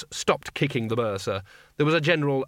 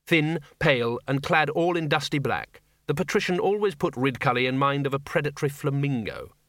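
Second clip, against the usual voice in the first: ordinary delivery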